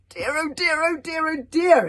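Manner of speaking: loud high panicked cry; boy exclaiming in shock; horrified exclamation. A narrator's reading. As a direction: gasp it out in horror. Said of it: "exclaimed Hiccup in horror and surprise".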